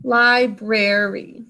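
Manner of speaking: "Library" said slowly for spelling; slow dictation. Shown as a demonstration. Teacher dictating the word while pupils write, slowly. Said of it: "write library".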